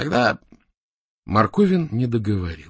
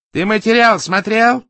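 high, loud, slow interrogation; angry demand; 10 semitones higher, 7 dB louder than usual. A narrator's reading. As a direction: demand an answer sharply. Enraged boss interrogates subordinate. (гневный допрос)

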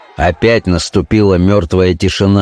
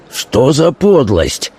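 higher, drawn-out indignation; outraged cry; 5 semitones higher, slower than usual. A narrator's reading. Notary crying out at the accusation, outraged. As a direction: stretch it out in mild outrage. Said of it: "закричал, наливаясь кровью, нотариус".

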